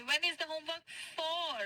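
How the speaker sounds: the full question drawn out slowly; teacher corrects the mistake, word by word; slow correct model. Shown as a demonstration. Teacher gives students the right form slowly. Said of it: "submit कब करना है".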